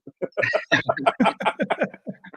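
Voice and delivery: squeaky wheezing giggle; high airy laughter; high breathless laugh. Real, not acted. Laughing at the memory.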